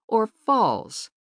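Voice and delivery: "falls" starting high and dropping down; falling inflection on FALLS. Shown as a demonstration. Narration coach demonstrating a marked downward arrow, falling inflection on "falls". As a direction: let the pitch fall away through "falls". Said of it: "a downward arrow on the word falls".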